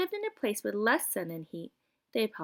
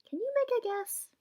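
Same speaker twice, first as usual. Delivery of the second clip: playful question; 14 semitones higher than usual. Acted. Reader asks children.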